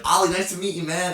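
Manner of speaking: high, loud American "Ollie, nice to meet you"; mock American. Retelling the owner's friendly American greeting, high and loud. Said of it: "doing that good of an impression".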